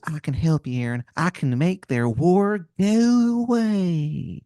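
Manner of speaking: put-on Maryland accent rising into a long drawl; bad Maryland accent, drag out the ending; Wizard of Baltimore, Maryland accent. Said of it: "this man's accent is something".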